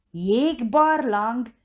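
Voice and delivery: offended. A narrator's reading. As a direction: draw the word out in offended disbelief. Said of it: "kérdezte valaki sértődötten". (sértődötten, elnyújtva)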